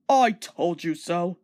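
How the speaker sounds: slow, mildly smug told-you-so; proud engine gloating at Edward; rudely. A narrator's reading. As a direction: drag it out rudely. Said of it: "said Gordon rudely".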